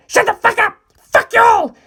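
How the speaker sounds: high, loud raging curses; high, loud teen fury; furious yelling. A narrator's reading. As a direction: scream it at everyone in fury. Narancia raging on.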